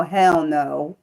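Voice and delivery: ordinary talk, nothing marked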